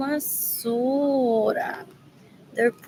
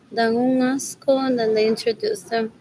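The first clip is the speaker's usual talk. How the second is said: usual speaking voice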